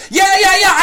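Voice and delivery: high, loud eager yeahs; enthusiastic guy. Retold or imitated. Comic describing heckler's eager yeahs. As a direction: gush like an overexcited fan. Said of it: "he'd be like".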